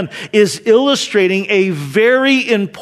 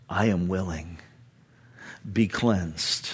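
much lower and softer, like a gentle promise; hushed, tender words; 12 semitones lower, 10 dB quieter than usual. Retold or imitated. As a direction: say it softly, as a healer would. Quoting Jesus, hushed.

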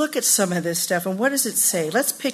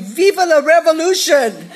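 higher, louder mock revolutionary cheer; mock rallying cry; 6 semitones higher, 8 dB louder than usual. Teen burning bras, hammed-up louder revolutionary shout.

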